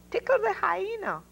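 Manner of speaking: high, calm voice from above; the Lord. A narrator's reading. The Lord answering Noah's prayer, high and calm. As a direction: speak as God giving a strange order. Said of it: "replied the Lord".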